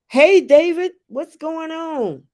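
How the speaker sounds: high, loud hello easing into welcomes; excited greeting. Real, not acted. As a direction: call out a big hello to a newcomer. Greeting a newcomer to the live chat.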